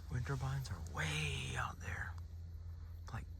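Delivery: slow soft whisper. Real, not acted. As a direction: breathe it out, hushed. Quiet hunter describing the view.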